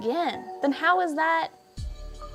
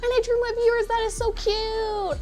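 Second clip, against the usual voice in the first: high, gushing and delighted; cute anime streamer; 9 semitones higher than usual. Real, not acted. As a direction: coo over it in a high voice. Delighted by viewer drawing.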